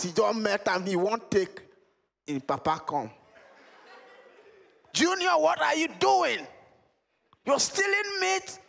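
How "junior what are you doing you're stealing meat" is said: much higher scolding dad; high, accusing dad; stern father voice. Acted. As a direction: play the outraged father catching his son. Father catches son stealing meat.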